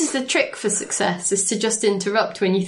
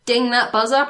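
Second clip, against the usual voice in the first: ordinary talk, nothing marked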